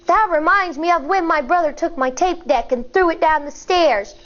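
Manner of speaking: ordinary delivery, nothing marked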